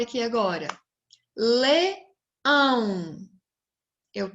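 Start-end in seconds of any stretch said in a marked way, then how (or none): 1.3-3.2 LEÃO broken slowly into "Le... ão"; syllable by syllable. Shown as a demonstration. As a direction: split Leão into two drawn-out syllables, Le - ão. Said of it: "Vamos separar essa sílaba?".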